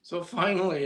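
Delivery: usual speaking voice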